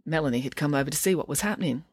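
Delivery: ordinary delivery, nothing marked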